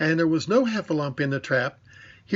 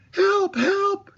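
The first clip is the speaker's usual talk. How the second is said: very high, louder panicked squeal; Piglet crying help; 4 dB louder than usual. A narrator's reading. Piglet sees the Heffalump and cries out in high panic. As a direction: shriek for help like a terrified little pig. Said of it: "cried Piglet".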